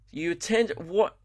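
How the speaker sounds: ordinary delivery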